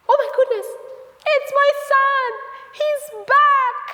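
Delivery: very high, loud, amazed cries; thrilled high-pitched exclaiming; overjoyed shout. Acted. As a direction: cry out with delight at who you see. Father overjoyed at son's return.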